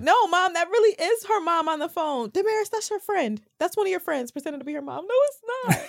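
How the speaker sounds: put-on high, pleading teenage protest. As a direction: insist to mom like a caught kid. Acting out arguing with mom.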